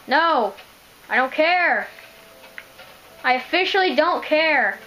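loud, high defiant yell; defiant shout. Real, not acted. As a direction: shout it like nothing matters. Yelling defiantly after the find.

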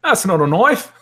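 high, hammed-up Australian movie quote; terrible Australian accent. Host cracking a cheap joke, badly faked Australian accent. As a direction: do a bad Aussie film line. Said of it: "That was a terrible Australian accent".

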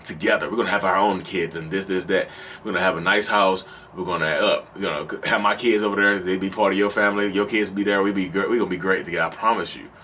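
low-voiced, smooth promises from a cheater; married man promising. Married narcissist promising a lover a future, low-pitched. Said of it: "let's say I, I'm married and whatever. I'm promising".

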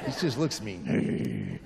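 low, slow, deadpan; wife's flat remark. Retold or imitated. Wife watching Cheney on TV, slow deadpan. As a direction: drag it out, unimpressed. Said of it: "my wife would look at me and go".

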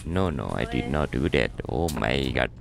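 put-on foreign character protesting. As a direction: plead innocence in a low character voice.

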